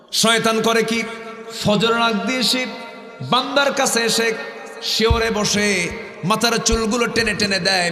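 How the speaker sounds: high, loud sermon voice, slow in the middle; full-force preaching. Acted. Preacher voicing God's call to the congregation, fervent. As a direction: preach it out with fervour.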